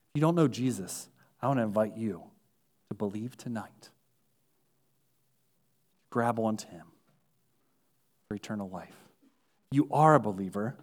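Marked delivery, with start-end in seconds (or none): 0.1-9.0 soft, lower altar-call voice; quiet invitation. Acted. Preacher softly invites unbelievers to faith tonight, quiet. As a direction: gently invite them to believe.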